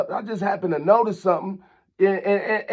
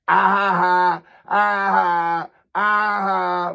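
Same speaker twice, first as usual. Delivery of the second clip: mock laugh; much slower than usual. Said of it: "Yeah, laugh at that".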